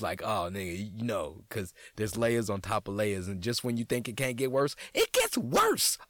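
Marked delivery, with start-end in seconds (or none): 4.9-6.0 slow squeaky falsetto punchline; high, slow mock-horror squeak. Punchline about the bit worsening.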